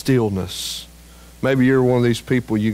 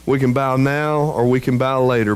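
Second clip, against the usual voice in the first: normal talking voice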